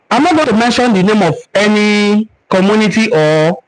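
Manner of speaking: ordinary talk, nothing marked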